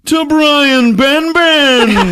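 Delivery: slow, high, loud, drawn-out reveal with a laugh; excited announcement. Acted. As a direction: trumpet his name like a grand reveal. Announcing actor's big entrance.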